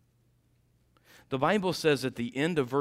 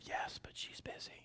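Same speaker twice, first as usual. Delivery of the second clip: put-on child's whisper; 16 dB quieter than usual.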